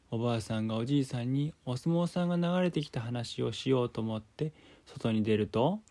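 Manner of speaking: plain reading voice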